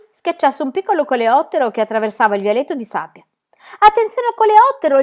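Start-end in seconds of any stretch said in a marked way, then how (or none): 3.8-5.0 much higher, louder urgent shout; shouting warning. A narrator's reading. Pippi warns Tommy about the beetle, urgent shout. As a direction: yell to stop him stepping. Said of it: "gli gridò Pippi".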